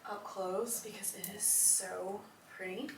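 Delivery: soft hushed whisper. Real, not acted. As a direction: whisper it close. Showing pattern up close.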